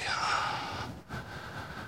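long, soft sigh of giving in; resigned sigh. Acted. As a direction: let out a slow breath in surrender. Gives in to the Lord.

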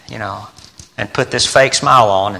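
normal talking voice

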